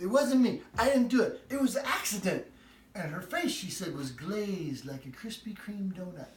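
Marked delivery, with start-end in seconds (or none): 0.0-2.4 put-on child's excuse. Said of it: "around the corner and says".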